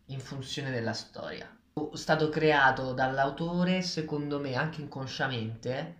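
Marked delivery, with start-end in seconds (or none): none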